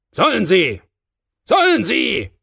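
loud, high exclamation, rising in force; defiant shout. A narrator's reading. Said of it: "rief er".

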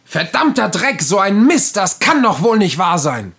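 high, furious cursing; angry outburst. Shown as a demonstration. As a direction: blow up in frustration. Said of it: "Darf's eigentlich auch mal ein wenig Emotion sein?".

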